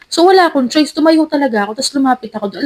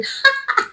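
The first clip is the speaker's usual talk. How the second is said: high shrill burst of laughter, shown off; her own loud laugh; 13 semitones higher, 5 dB quieter than usual. Retold or imitated. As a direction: show everyone how you laugh, a quick high cackle. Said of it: "Alam mo naman yung tawa ko".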